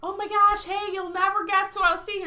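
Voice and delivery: high-pitched gushing setup; excited greeting. Retold or imitated. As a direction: act out breathless news for a friend. Acting out her setup of prank.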